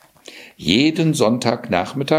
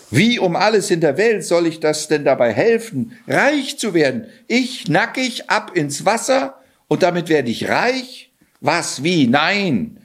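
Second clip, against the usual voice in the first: lightly voiced Johann, baffled and protesting; bewildered young man; 7 semitones higher than usual. Johann protests to the cat about jumping in, lightly voiced bewilderment. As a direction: balk at the crazy plan, higher and incredulous.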